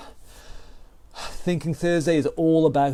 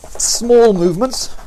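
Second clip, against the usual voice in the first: higher, louder, slow and stretched out; emphatic coaching cue; 7 semitones higher, 7 dB louder, slower than usual. Real, not acted. Coach urging the class mid-round to keep movements small, high and emphatic. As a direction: lean on it to drive the point home.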